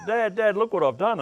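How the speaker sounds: high, loud panicked call; retold yell. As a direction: shout for Dad after the accident. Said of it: "yelled out".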